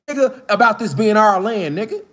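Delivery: ordinary talk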